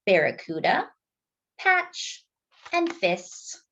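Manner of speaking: ordinary delivery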